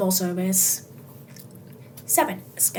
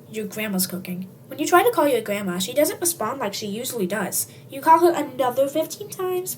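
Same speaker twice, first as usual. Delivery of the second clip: plain reading voice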